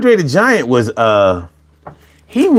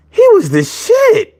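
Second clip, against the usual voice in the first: excited. Acted. Hyping a favorite wrestler.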